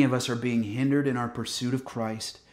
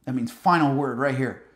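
ordinary delivery

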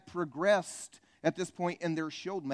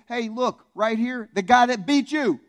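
taunting the enemy; 6 semitones higher, 9 dB louder, slower than usual. Pretend. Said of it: "remind the enemy".

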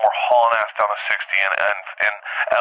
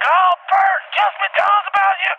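mock high girly voice. Girls voiced in retold dream.

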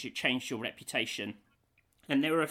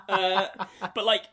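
ordinary talk, nothing marked

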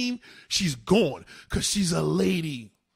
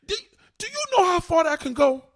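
emphatic high question; 14 semitones higher, 3 dB louder than usual. Real, not acted.

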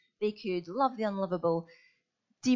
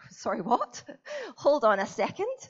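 baffled, laughing double take; mock disbelief; 6 semitones higher, 3 dB louder than usual. Young player objecting to coach's instruction, comically incredulous. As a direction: pitch jumping up in comic protest.

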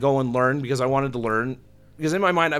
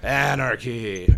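drawn-out, louder echo of the name; slow echoing name call; 4 dB louder, much slower than usual. Retold or imitated. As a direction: stretch the name slowly as an echo. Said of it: "then I'd rip off Mister Anderson with".

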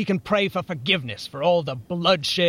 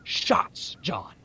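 hushed, unvoiced whisper of a plotter; conspiratorial whisper; 6 semitones higher, much slower than usual. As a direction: breathe it like a secret shared across the table.

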